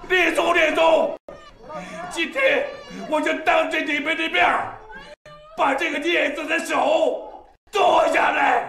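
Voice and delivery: father swearing to his ancestors that he will cut off the hand; solemn furious shout. Acted. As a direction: proclaim the punishment before the shrine. Father vows before the ancestors.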